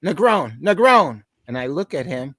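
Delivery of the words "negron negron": boss calling out. Retold or imitated. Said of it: "he goes".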